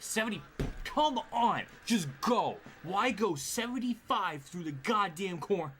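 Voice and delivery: high, loud yell at the cars ahead; frustrated yelling. Real, not acted. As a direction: shout at the slow drivers, fed up.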